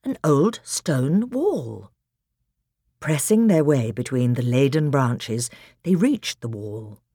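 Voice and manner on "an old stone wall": slow. Acted. Peter slowly observes.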